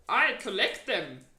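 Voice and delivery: loud, folksy friendly giant. A narrator's reading. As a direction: play the big kind giant.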